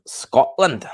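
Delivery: put-on drawn-out stab at a Scottish accent; attempted Scottish accent. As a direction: have a go at Scottish, badly. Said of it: "I can't do the Scottish accent".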